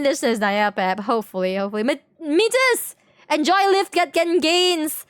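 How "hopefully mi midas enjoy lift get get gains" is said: normal talking voice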